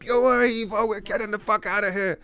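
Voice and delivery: high, loud rallying cry; mock heroic rescue shout. Telling Evo not to worry, a high excited rescue shout. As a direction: shout it like a hero hauling a buddy out. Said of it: "carry like".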